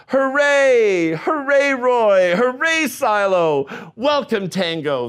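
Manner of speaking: high, loud cheers; the penguins cheer the new family. Acted. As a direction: shout hooray like a happy crowd. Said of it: "they cheered".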